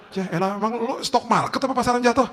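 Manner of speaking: high, loud scoff; mocking outburst. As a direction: scoff at a friend's silly excuse. Mocking friend's excuse in acted quarrel. (cemoohan keras bernada tinggi)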